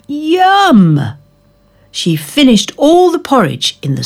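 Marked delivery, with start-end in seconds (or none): 0.1-1.2 much higher, louder squeal of delight; a happy child's yum; gleeful yum. Acted. Goldilocks enjoys porridge.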